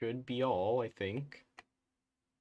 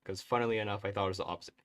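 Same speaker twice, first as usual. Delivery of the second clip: ordinary talk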